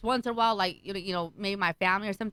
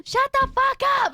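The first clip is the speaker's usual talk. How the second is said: put-on high, loud outburst at the kids; retold yelling; 7 dB louder than usual. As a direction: yell it like a fed-up mother. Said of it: "yelling at him".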